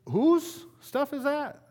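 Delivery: slow, high mock-challenging God; God asking. Pastor voicing God questioning whose stuff it is, slow and high. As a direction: draw it out like God asking pointedly.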